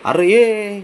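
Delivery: loud, high-pitched call; exasperated call. Real, not acted. Owner calling a pet that will not listen, loud exasperated call. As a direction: call out loudly, voice rising.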